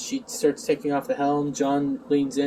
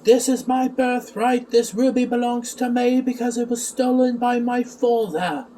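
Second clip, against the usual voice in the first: John; 7 semitones higher than usual. Retold or imitated. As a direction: play the aggrieved heir in a raised voice. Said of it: "John goes like".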